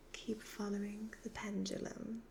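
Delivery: usual speaking voice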